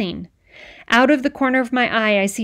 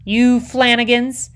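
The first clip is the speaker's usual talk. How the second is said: exasperated outburst; 3 semitones higher, 5 dB louder, slower than usual. A narrator's reading.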